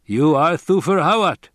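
high, loud, certain statement; matter-of-fact. A narrator's reading. Fremen naming Hawat to his face, matter-of-fact. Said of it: "said matter-of-factly".

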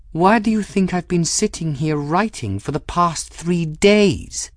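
high, stinging impatient retort; irritable, pitched well above normal; waspish. A narrator's reading. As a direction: throw the question back waspishly. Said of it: "said in a waspish tone".

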